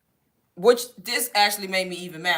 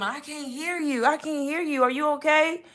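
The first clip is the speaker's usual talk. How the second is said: mocking phone agent; 7 semitones higher than usual. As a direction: parody the agent pretending not to hear. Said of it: "she kept saying".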